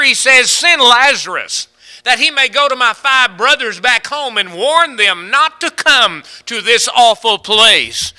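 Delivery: loud, high, fiery preacher's cry; impassioned revival preaching; preaching at full force. Acted. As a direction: thunder it out from the pulpit. Preaching on the rich man.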